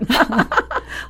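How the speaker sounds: short high laugh at herself; a bright burst of laughter, amused; real laugh. Real, not acted. Laughs at own story. (自嘲地笑出声)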